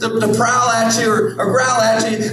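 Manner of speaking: high, hammed-up cheery villain announcing himself; mock Satan. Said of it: "Satan doesn't just come in".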